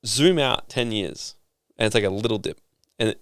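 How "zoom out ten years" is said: slowed, deliberate words; slow calm advice. Retold or imitated. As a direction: spell it out patiently. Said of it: "he's like".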